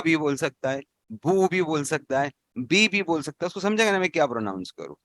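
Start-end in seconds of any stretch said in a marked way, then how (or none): 1.1-1.5 बू said as a rounded boo; long vowel boo. Shown as a demonstration. Teacher demonstrating बू to students. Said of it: "अगर बगैर हरकत के कोई अजमी प्रोनाउंस करेगा". 2.6-2.9 बी said as a bright bee; long vowel bee. Shown as a demonstration. Teacher demonstrating बी to students. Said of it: "अगर बगैर हरकत के कोई अजमी प्रोनाउंस करेगा".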